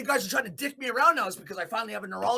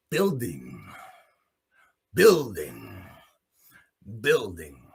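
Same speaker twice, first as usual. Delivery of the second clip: much lower, drawn out, each word falling; slow menacing repeat; 12 semitones lower, much slower than usual. Real, not acted. Describing his months of preparation, low menacing repeats. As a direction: brood over each word.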